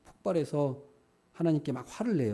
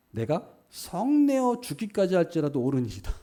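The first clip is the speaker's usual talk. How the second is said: raised, defiant insistence; stubborn angry reply; 6 semitones higher, 5 dB louder than usual. Retold or imitated. Jonah answering God, a defiant high angry retort. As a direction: Jonah stubbornly talking back, high and loud. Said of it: "요나가 얼마나 감정적이었는지".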